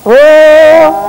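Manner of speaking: high, loud drawn-out "oh"; loud, high wail on an open vowel; loud cry on request. Real, not acted. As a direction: let a long loud cry burst out high. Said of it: "Oh, more louder".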